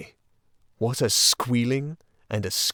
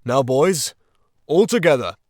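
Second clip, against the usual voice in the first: loud, high rallying shout; rallying call; 5 semitones higher, 9 dB louder than usual. A narrator's reading. Badger urging his friends to push the trap door, loud shout. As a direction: rouse the team to heave together.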